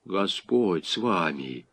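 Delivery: soft, low, slow protest; dumbfounded. A narrator's reading. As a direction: recoil from the accusation in quiet shock. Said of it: "ошарашенно вытаращил глаза Смирнов". (тихо и ошарашенно)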